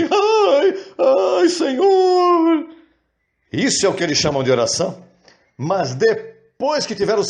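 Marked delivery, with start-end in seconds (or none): 0.0-2.7 very high, slow sobbing wail; hammed-up weeping to the Lord; mock crying wail. Preacher imitates believers crying in prayer, high slow hammed-up sob. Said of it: "E chorando, assim".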